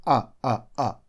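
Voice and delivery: "а, а, а" as three short separate vowels; short schwa. Shown as a demonstration. Teacher showing the unstressed schwa sound, "а" said very short. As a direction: cut each "а" short, a quick uh. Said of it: "Вот так вот коротко".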